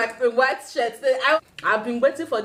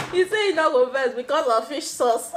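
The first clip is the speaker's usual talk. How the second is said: laughing; 2 semitones higher than usual. Real, not acted. Laughing retelling.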